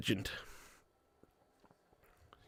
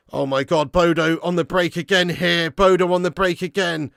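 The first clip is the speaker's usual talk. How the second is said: alarmed commentary; 3 semitones higher, 7 dB louder than usual. Real, not acted. Alarm at opposition counter.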